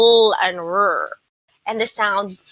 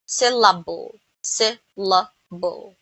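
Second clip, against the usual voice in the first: "Syllable" broken into syl-la-ble; slowly by syllables; 2 semitones lower, slower than usual. Shown as a demonstration. Teacher counting chin touches, "Syllable" slow and separated. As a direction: say "Syllable" slowly, a gap between the parts. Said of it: "say the word slowly".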